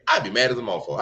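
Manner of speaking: normal talking voice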